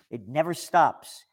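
ordinary delivery

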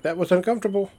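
reacting to forced praise, awkward. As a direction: squirm through it.

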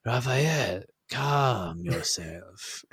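slow, soft wise-master voice; hushed, very slow mentor; imitating Splinter. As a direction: intone it like a sage rat. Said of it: "He's like".

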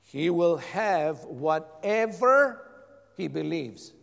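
slow, loud build to "whatever"; emphatic proclamation. Real, not acted. Preacher testing the congregation on the verse, emphatic proclamation. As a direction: stretch it out, punching the key words.

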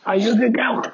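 high, sing-song pet talk; talking to a dog. Real, not acted. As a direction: coo at the dog.

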